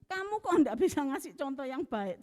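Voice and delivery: imitating the accuser scolding her; retold finger-pointing scolding. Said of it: "diduting-duting gitu".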